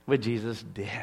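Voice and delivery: soft unvoiced whisper. Preacher whispers to the congregation, hushed. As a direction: breathe it like a secret.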